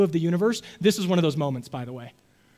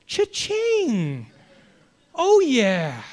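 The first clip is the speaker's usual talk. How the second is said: gleeful cha-ching; 6 semitones higher, much slower than usual. Retold or imitated. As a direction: celebrate the lucky break. Relief at broken clock.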